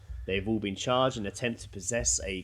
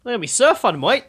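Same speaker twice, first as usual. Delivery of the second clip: much higher, louder hammed-up Aussie; mock Australian accent; 10 semitones higher, 10 dB louder than usual. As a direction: play a surfer bloke with a bad Aussie accent. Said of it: "don't know what accent I was doing".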